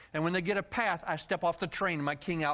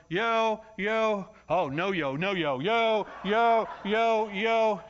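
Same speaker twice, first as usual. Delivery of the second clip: calling out yo; 3 semitones higher, 4 dB louder, much slower than usual. Retold or imitated. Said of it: "So I'm going".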